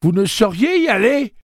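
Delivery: high, loud horrified cry; shocked cry. A narrator's reading. Jeeves crying out in shock at the idea. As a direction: cry out in horror. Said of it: "cria-t-il".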